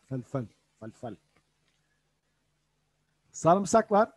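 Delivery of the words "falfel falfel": Falfel said softly and evenly; Arabic pronunciation. Shown as a demonstration. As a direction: repeat Falfel gently for learners. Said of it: "Falfel diyorlardı bu bibere". (Falfel kelimesini yumuşakça tekrarla)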